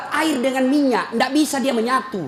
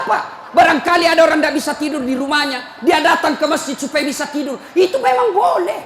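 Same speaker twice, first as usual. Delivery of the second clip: loud, high scolding sermon voice; fiery preaching; 6 semitones higher, 4 dB louder than usual. Acted. Preacher scolding the mosque committee, loud and fiery. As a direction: preach it hard at the committee, laughing between.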